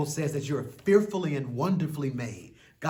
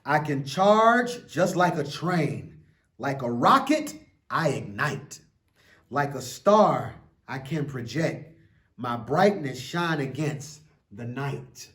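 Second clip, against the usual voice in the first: put-on slow, lilting read-aloud for children; an expressive children's book reading; story reading; 8 dB louder, slower than usual. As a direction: read it like a bedtime picture book. Reads picture book aloud.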